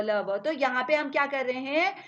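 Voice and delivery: usual speaking voice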